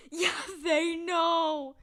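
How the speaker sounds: loud, very slow ominous; loud dread. Real, not acted. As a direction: drag it out in horror. Realising cats know.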